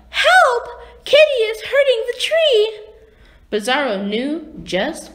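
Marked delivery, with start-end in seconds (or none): 0.1-2.6 loud, very high cry for help. A narrator's reading. Woman on Bizarro World calls for rescue, high loud cry. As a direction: call out in alarm, pitched right up. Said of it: "a woman cried out".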